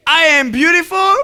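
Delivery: high, loud cheer-leading line; call for repetition. Shown as a demonstration. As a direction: shout it out for the girls to echo. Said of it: "I want all the girls to say".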